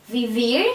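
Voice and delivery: vivir with the second syllable "vir" stressed and rising; teacher's demo of vivir, stressed at the end; stress on final syllable. Shown as a demonstration. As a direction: lean on the "ir" ending of vivir. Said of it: "stress ir".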